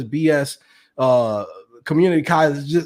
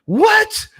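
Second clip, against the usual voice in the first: a sudden very high what; jaw-drop squeak of disbelief; shocked. Retold or imitated. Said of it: "thinking in my head, like".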